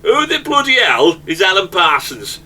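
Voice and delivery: put-on playful northern English accent. Host hamming a northern accent to his panel. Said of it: "I don't know why I'm going to a northern accent".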